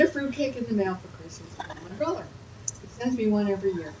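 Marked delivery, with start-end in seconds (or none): none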